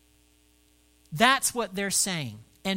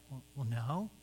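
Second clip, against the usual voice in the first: shocked mumble; 5 semitones lower, 8 dB quieter than usual. Retold or imitated. Dennis caught by his pastor, low, soft and stunned. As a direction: lower and much softer, caught off guard. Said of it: "this look of shock".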